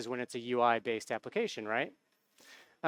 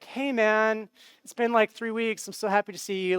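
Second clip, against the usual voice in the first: a lightly voiced buddy, casual and upbeat; relaxed guy greeting a friend, only slightly higher; easygoing Gerald; 6 semitones higher than usual. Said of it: "Gerald would be more like".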